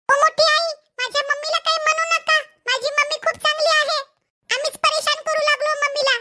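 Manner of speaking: put-on a little boy protecting his mother; child defending mom.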